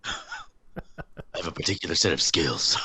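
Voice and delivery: low, slow, menacing movie growl, lightly done; Liam Neeson impression. Liam Neeson guarding his house, lightly done menace. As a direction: lightly imitate a grim action hero threat. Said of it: "Liam Neeson's Home Alone".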